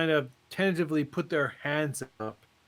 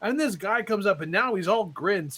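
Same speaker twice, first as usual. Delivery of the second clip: ordinary talk, nothing marked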